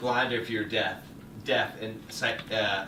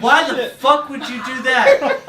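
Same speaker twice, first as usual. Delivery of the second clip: incredulous laugh; 9 semitones higher, 6 dB louder than usual. Retold or imitated. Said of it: "I look at him like".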